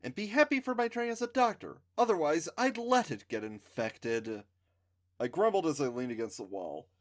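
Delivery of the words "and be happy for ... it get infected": haughty. A narrator's reading. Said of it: "Wendy said haughtily".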